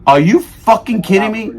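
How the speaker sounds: outraged disbelief, repeated. Retold or imitated. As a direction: hammer the disbelief a second time.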